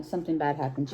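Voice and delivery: ordinary delivery, nothing marked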